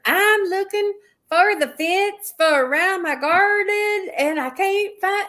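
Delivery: high, sweet Southern mom voice; imitating her Southern mother, pitched well up; Southern accent. Mom asking the loudspeaker for garden fencing, in a high Southern accent. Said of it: "my mom's Southern".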